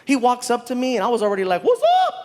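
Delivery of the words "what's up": high, squeaky, laughing hello; high-pitched greeting. Retold or imitated. Comic greeting a prince, high and cheeky.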